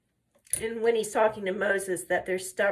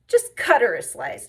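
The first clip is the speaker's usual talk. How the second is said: louder mock order, insisting; retold impatient demand; 8 dB louder than usual. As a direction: imitate someone insisting, with a push in volume. Retold demand, Tilly insisting on pie.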